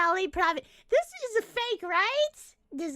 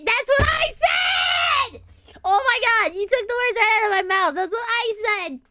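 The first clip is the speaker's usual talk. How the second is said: loud, high burst of vindication; excited outburst; 5 semitones higher, 7 dB louder, faster than usual. Real, not acted. Streamer finding someone said the same thing, loud and excited. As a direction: shout it out, thrilled to be echoed.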